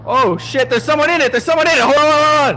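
sudden high, loud alarm; startled shout. Real, not acted. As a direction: shout it in real shock. Spots someone in the car.

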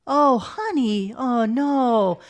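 put-on slow, patronizing baby talk; restrained fake baby voice. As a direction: draw it out like soothing a child. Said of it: "patronizing, restrained baby voice he puts on".

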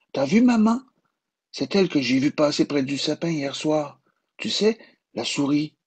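put-on a little boy excitedly pointing something out to mum; voix d'enfant. A narrator's reading. Enfant parle à sa maman. (voix d'enfant excitée et aiguë)

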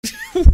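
sudden shrieking laugh; loud high laugh burst. Real, not acted. Gamer shocked by the game, loud high laugh. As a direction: cackle out in disbelief.

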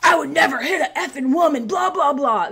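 mock his loud, belligerent protest; belligerent drunk. Drunk fan denies hitting her, imitated belligerence. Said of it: "he's belligerently drunk at this point".

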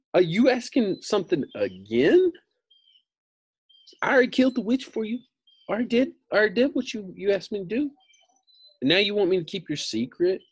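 high, fast, exasperated protest; Dorothy complains she already killed the witch; indignant girl. Acted. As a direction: rattle it off in disbelief. Dorothy indignant at Oz's demand.

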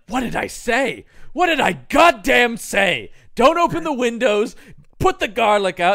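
loud, high-pitched mock scolding; Van Helsing exasperated. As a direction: bawl them out in hammed-up exasperation. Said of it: "Van Helsing arrives, and he's like".

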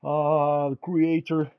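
ordinary talk

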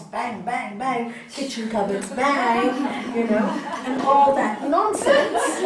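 loud imitated bang, bang; mock banging. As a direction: hammer out the bangs half laughing. Banging pots in bad mood.

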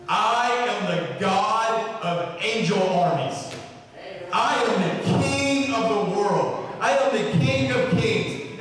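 slow, weighty declaration, rising in pitch; grand proclamation. Retold or imitated. Jesus declaring his kingship to Nathanael, grand proclamation. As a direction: declare each title slowly, building upward.

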